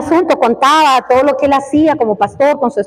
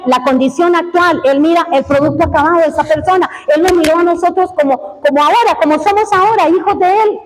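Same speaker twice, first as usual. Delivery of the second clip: fervent preaching; 6 semitones higher than usual. Acted. Preacher telling the church how God sees them, fervent and raised. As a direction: lift the congregation with conviction.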